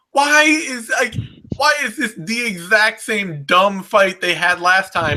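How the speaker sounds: exasperated shout. Retold or imitated. Imitating a fan's frustration.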